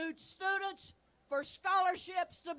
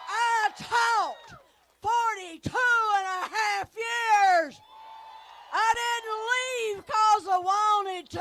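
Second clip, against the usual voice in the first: loud, firm classroom projection; teacher voice; 4 dB louder than usual. Real, not acted. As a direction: project like addressing a noisy classroom. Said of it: "I'm gonna be using my teacher voice".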